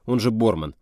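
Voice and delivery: usual speaking voice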